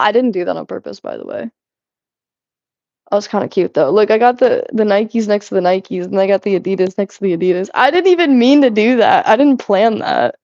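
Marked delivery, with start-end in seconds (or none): none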